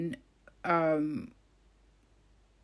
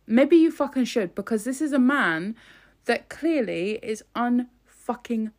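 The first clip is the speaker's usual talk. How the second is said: loud, higher, angry tirade; furious rant; 5 semitones higher, 5 dB louder than usual. Real, not acted. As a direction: shout your outrage at them. Furious rant at Downing Street.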